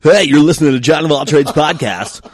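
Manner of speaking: high, loud morning show host. Shown as a demonstration. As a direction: hype morning radio DJ. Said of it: "Boomer and the Nudge voice".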